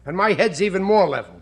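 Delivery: ordinary delivery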